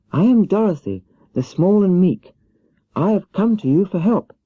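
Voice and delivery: put-on little girl pleading for help; young Dorothy. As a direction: play Dorothy small and meek, pitched high. Dorothy voiced by narrator.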